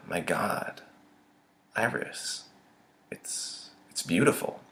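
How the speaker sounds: soft, breath-filled wonder; halting, near-whispered amazement; hushed awe. A narrator's reading. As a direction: sigh it out softly, awestruck. Awed by the sunset sky.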